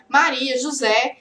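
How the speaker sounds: normal talking voice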